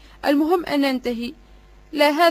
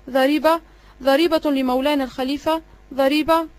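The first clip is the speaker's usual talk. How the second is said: ordinary delivery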